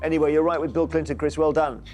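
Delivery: ordinary talk, nothing marked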